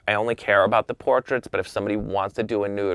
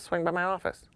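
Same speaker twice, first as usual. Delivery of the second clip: softer, higher, casual imitated invitation; offhand invitation; 9 semitones higher, 5 dB quieter than usual. Camera executive inviting the photographer over, offhand and soft. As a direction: toss it off softly, imitating him.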